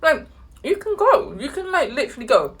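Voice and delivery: high, pleading, exasperated; exasperated high plea. Retold or imitated. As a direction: beg her to just go. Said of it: "I'm like".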